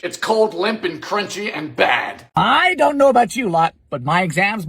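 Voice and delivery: ordinary talk, nothing marked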